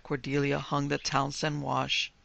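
plain reading voice

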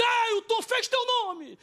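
imitated triumphant shouting; acted crowd cheer. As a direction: cheer him on like the whole room. Room cheering the winning bid.

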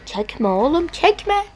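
second word jumps high and loud; pleading yell at the game, rising on the repeat; exasperated plea. Real, not acted. Player yelling at the game not to pull, rising plea.

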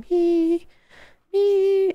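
very high, drawn-out squeal; eager mock audience. Streamer answering for an audience that isn't here, eager mock squeal. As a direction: pipe up far above your normal voice. Answering own question as the crowd.

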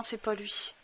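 normal talking voice

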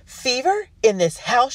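high, loud appalled guest; horrified exclamation. A narrator's reading. Guest hears of fever in the house, high horrified exclamation. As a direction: exclaim it loudly in shock. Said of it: "she exclaimed".

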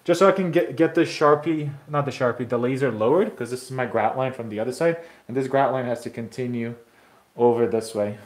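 normal talking voice

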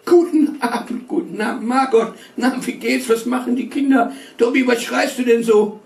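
high, chatty greeting visitor; cheerful woman's voice. A narrator's reading. Visiting aunt greets the family cheerfully. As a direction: greet the hosts brightly up high.

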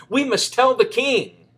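high, loud and urgent; urgent cry. Acted. Chicken Little urging the fox, high urgent cry. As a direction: insist in a panic. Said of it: "cried Chicken Little".

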